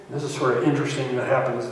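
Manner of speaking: normal talking voice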